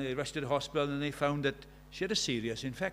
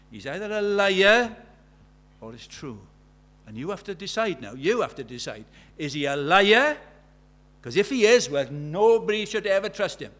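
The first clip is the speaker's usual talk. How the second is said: forceful preaching; 4 semitones higher, 10 dB louder than usual. Real, not acted. Preacher pressing the choice.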